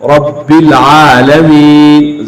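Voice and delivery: "रब्बिल आलमीन" slowly chanted, ending on a silent n; pause-form recitation. Shown as a demonstration. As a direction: stop on the final letter. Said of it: "वक़फ़न क्या हो गया". (वक़्फ़ के साथ तिलावत)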